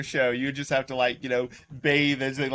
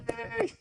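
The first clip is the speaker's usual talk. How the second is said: thin, very high, soft yay; mock crowd cheer; 7 dB quieter than usual. Audience clapping for the insult, small high mock cheer. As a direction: imitate a distant studio audience cheering.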